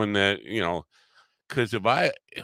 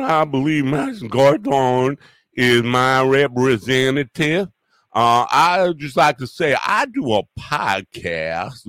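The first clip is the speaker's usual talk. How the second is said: put-on terrible Southern accent; 5 dB louder than usual. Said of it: "do a better Southern accent".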